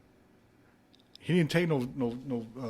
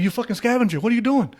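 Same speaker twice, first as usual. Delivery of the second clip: much louder, high laughing scold; mock outrage; 9 semitones higher, 7 dB louder than usual. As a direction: bust him digging in trash. Catching roommate in trash.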